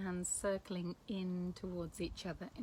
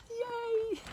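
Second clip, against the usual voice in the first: a much higher, gleeful little yay; excited yay; 6 dB louder than usual. Shown as a demonstration. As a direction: squeal it out like a delighted child. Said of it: "I also do that when I'm excited. I go".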